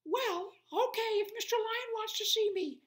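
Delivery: very high, loud squeak; mouse voice. Acted. Tiny mouse agreeing to see the lion, squeaky.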